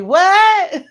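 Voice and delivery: high, loud "what?!" breaking into laughter; high-pitched, incredulous yelp; astonished reaction. Retold or imitated. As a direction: blurt it in amused disbelief. Acts out surprise at accent compliment.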